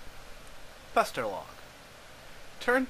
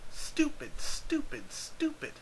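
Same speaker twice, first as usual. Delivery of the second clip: mock self-scolding; 13 semitones higher, slower than usual. Narrator scolds himself mockingly.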